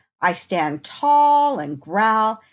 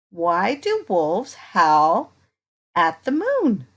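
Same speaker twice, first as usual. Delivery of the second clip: ordinary delivery, nothing marked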